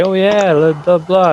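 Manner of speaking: high-pitched mock promise; mock agreement. Host mimicking another band promising to call in, mock-eager.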